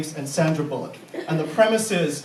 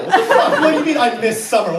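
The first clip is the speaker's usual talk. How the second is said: surprised outburst; 4 semitones higher, 6 dB louder, faster than usual. Real, not acted. Reacting to heckle.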